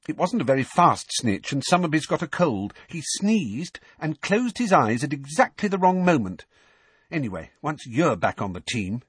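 put-on high-pitched, offhand young player; Ginny. Ginny voiced in narration.

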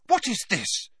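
high-pitched startled question; shocked question. A narrator's reading. Girl shocked by two sudden appearances, asking in a high voice. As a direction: demand it in shock. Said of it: "still looking shocked".

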